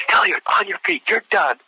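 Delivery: high, mock-yelled dismissal; drill-instructor order. Sergeant ending the candidate's push-ups, imitated yell. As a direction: imitate an exasperated sergeant calling a halt.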